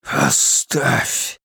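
voiceless, effortful rasp; strained croak. A narrator's reading. Captain stopping the mechanic mid-seizure, strained and croaking. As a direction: force the word out weakly.

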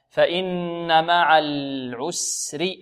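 فإن with a long nasal hum on ن; held doubled noon. Shown as a demonstration. Teacher demonstrating ghunna, slow recitation with held noon. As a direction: recite slowly, holding the ن of فإن. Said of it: "النون مشددة".

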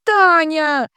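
high, loud drawn-out bellow; outraged howl, stretched out; roar. A narrator's reading. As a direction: roar the name in pained outrage. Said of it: "взревела та".

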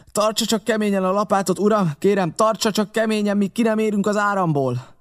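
high, sustained urging; urgent captain. Acted. Captain to the helmsman in the current, urgent and high.

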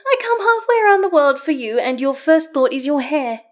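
very high, loud outraged wail; mock indignation. A narrator's reading. Karlach came round the world for Astarion, mock indignant. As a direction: exclaim it as if deeply offended.

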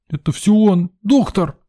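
put-on high-pitched, weepy boy's voice; tearful outburst. A narrator's reading. Boy blames the doctor, breaking into tears. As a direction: blurt it like a child giving in to tears. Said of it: "не сдержался и всё-таки зарыдал".

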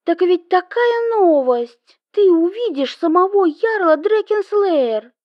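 high, eager gossip; excited chatter. Acted. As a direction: blurt out the big news. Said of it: "затараторила в трубку".

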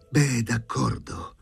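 drawn-out hateful grudging reply; sudden hatred. A narrator's reading. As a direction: agree with hate in your voice. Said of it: "disse all'improvviso con odio".

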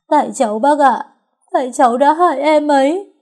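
high, loud anguished cry; tearful self-blame. A narrator's reading. Crying girl telling the neighbour it is her fault, anguished. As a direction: wail the confession, pitch climbing. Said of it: "ôm lấy thi thể em mà khóc".

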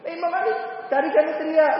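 high, exasperated-neighbour retort; mock indignant reply, pitched well up; acted exclamation. Neighbours answering in the retold fire story.

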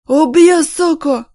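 very loud high yell; drunken threat. Acted. Drunk man roaring threats, very loud. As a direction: roar the threat.

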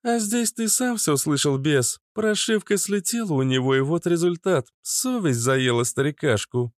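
teasing young woman, lightly mocking; high teasing female voice. A narrator's reading. Liana's character voice.

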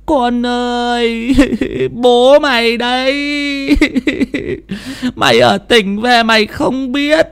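high, slow and eerie, as if from beyond; a wailing spirit calling its child, drawn out; eerie ghostly voice. Acted. (giọng hồn ma cao, chậm, rờn rợn)

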